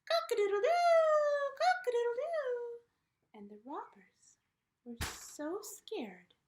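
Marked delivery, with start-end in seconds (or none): 0.1-2.8 very high, very loud crowing; rooster crow. Acted. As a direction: crow to scare the robbers. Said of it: "flew up".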